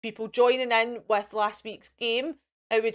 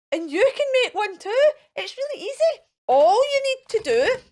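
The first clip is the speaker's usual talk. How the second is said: excited; 11 semitones higher, 5 dB louder than usual. Real, not acted. Teacher urging children at home to make one, excited. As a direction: sell it like the best idea ever. Enthusing to children about the craft.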